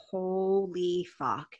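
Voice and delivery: very slow, drawn-out amazement; reenacted slow-motion wonder; retold stunned awe. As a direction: stretch it out as if overwhelmed. Retold first hit of the drug.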